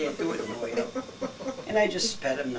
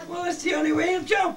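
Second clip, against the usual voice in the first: laughing shout; 13 semitones higher than usual. Real, not acted. Laughing story punchline to family.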